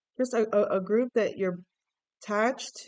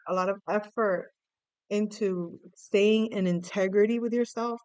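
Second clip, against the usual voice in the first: ordinary talk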